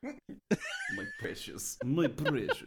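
high, strained creature croon with laughter; Gollum voice. Acted. Performing Gollum quotes for co-host, high creature voice. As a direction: drag it out like an obsessed creature. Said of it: "in your best Gollum voice".

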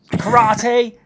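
sudden high, loud battle cry of karate; shouted karate. Retold or imitated. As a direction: bark the word out like a martial-arts yell. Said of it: "Just shouts the word karate".

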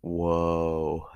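long drawn-out whoa; mock shocked whoa. Retelling her reaction to the tweet, a mock shocked whoa. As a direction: act scandalised by the tweet. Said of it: "I was like".

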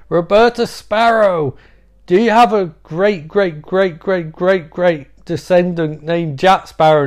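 high, loud, slow formal proclamation; grand solemn address. Retold or imitated. Donnie addressing Grandma Death, slow loud proclamation. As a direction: declaim it slowly, like a herald.

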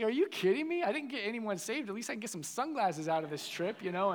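high, whiny mock outrage; retold indignation. Teenager complaining to his leader about losing sunglasses, indignant. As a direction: protest in a high, put-out pitch. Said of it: "I was so mad".

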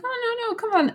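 very high teasing plea; squeaky mock coaxing; coaxing husband. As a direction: wheedle her to show it. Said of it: "And he's like".

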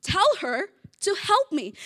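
blunt order to the Lord, high and loud; tell, don't ask; a stressed sister's command; demanding. Retold or imitated. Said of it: "She's saying".

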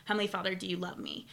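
soft, hushed plea to God; quiet prayer. Retold or imitated. Praying to Heavenly Father for peace, softly and hushed. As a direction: ask it gently, almost under your breath. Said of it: "I say".

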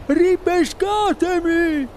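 high, drawn-out plea; boy's desperate yell. A narrator's reading. Boy begging to be fished out, desperate yell. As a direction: howl it from the water. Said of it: "urlò".

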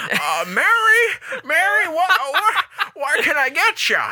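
high, loud call to Mary. Pretend. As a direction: call out across the car, laughing. Calling out to a friend.

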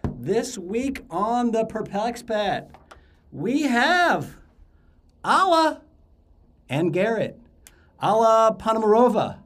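big welcome announcer voice; hyped host intro. Acted. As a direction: sell the show like a game-show host. Host's hyped show intro.

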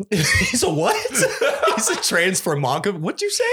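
laughing through a baffled question; can barely get the word out for laughing. Real, not acted. Friend cracking up at a made-up word, laughing.

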